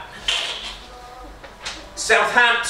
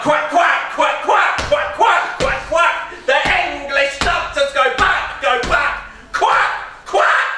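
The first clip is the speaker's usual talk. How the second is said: shouted quacking; 5 semitones higher, slower than usual. Pretend. Shouted duck finale of poem.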